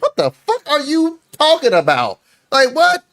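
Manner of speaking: high incredulous quote. Retold or imitated. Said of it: "I'm like".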